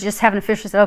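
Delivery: normal talking voice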